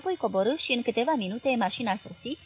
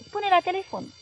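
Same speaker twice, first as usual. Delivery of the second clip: higher, mildly impatient voice; irritated, hurried; 10 semitones higher, 4 dB louder than usual. A narrator's reading. Tired boss hurrying his journalist on the phone, mildly irritated. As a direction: brush the caller off with pitch raised. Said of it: "zise patronul agasat și grăbit".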